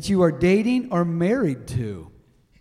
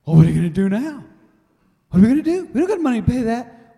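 high, rushed worried voice; fearful panic; 8 semitones higher, 8 dB louder, much faster than usual. Retold or imitated. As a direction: panicky quote of the wife. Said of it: "There's been times I was in fear".

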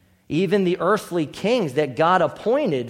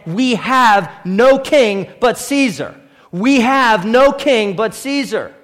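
loud declaration; 4 semitones higher, 7 dB louder, slower than usual. Acted. Crowd's rejecting declaration quoted.

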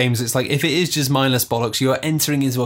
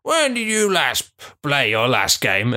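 put-on old man voice. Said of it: "I'm gonna do this in an old man voice now".

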